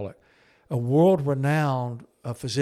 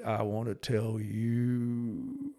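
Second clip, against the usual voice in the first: soft, very slow, halting line; choking up as the words stall; a hint of rattling emotion, held back; voice starting to break; 5 dB quieter, much slower than usual. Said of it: "his voice began to rattle".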